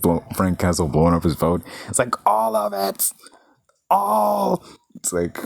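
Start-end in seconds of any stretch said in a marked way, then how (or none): 2.3-4.6 whispered then a high cry; imitating Travolta losing it; frantic disbelief. Retelling the boat scene, panicked cry. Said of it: "he sells it so well".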